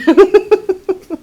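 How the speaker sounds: loud high laugh. Real, not acted. Cracking up loudly while reading the poem aloud.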